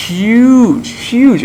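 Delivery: high-pitched, emphatic "huge". Real, not acted. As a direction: stretch each huge up high. Emphasising how big coffee is.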